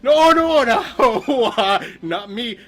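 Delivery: loud, high, dropping as it goes; panicked denial. Acted. Wolf denying Red's accusation, loud flustered protest. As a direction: burst out protesting, then back down.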